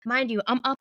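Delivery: usual speaking voice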